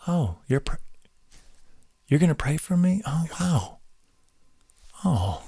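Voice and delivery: quietly overwhelmed by the gesture; hushed and moved, trailing off; touched, hushed. Retold or imitated. Imitating a touched listener's reaction.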